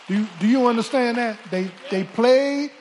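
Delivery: loud, rising preacher's cry; fiery pulpit shout; preaching shout. Real, not acted. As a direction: hammer it home to the congregation. Preacher drives the point home.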